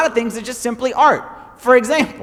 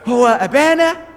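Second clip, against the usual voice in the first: mock preaching; 3 semitones higher, slower than usual. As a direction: ham up the earnest preacher. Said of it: "I'm up there and I'm saying this".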